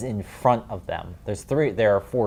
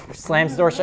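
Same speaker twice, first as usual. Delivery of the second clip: ordinary delivery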